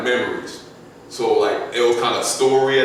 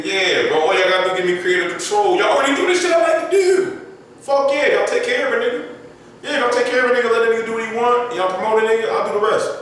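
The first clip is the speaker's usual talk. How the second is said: excited acceptance; 9 semitones higher, faster than usual. Retold or imitated. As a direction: play yourself thrilled at the offer, nearly shouting. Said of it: "if [name] hit my line right now and said".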